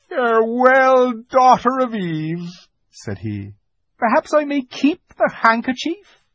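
high, slow faun goodbye; a sad faun parting; tender farewell. A narrator's reading.

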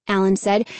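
ordinary delivery

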